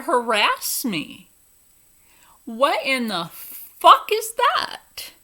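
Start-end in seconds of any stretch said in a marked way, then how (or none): 2.4-5.1 loud, higher, slow indignant outburst; slow furious demand; outraged. Real, not acted. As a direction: blast it with disbelief. Outraged exclamation.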